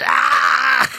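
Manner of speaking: long high "ah" held out; high shout. Pretend. Host peaking in mock hype, high yell. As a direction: yell out a sustained high cry.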